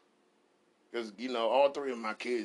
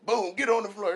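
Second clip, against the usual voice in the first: higher, louder barked command; mock cop raid; 10 semitones higher, 7 dB louder than usual. Dad playing a cop raiding his son's room, loud. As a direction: bust in like a cop. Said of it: "I'm dressing as the cop".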